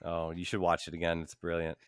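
normal talking voice

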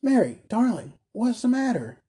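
high, worried calling; alarmed cry. A narrator's reading. Husband calls to his stricken wife, alarmed. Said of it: "cried the carrier".

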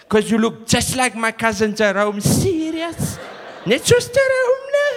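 put-on amazed, pitch shooting up in Cape Flats accent; high Cape Flats exclamation; Cape Flats server. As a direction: marvel shrilly at the resemblance, Cape Flats style.

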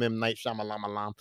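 Shyamalan said as "Shyama-lama-lam", the middle syllables doubled and dragged; deliberately mangled name. Shown as a demonstration. Vlogger teasing the director's name, deliberately mangling Shyamalan. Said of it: "so I always call him".

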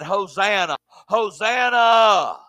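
slow, rising hosanna shout; crowd crying out. Retold or imitated. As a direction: cry it out like a crowd greeting a king. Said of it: "they cried".

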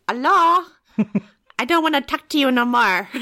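loud, high, hammed-up French taunt; outrageous film-Frenchman accent; mock French accent. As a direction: shout down in a silly French accent. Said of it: "That was my best French accent".

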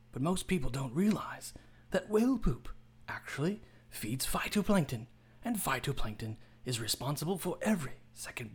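hushed, reverent nature documentary narration; David Attenborough impression. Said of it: "Do David Attenborough".

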